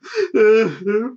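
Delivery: high, loud fake crying wail; hammed-up wail of mock despair; mock sob cry. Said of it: "and I just go".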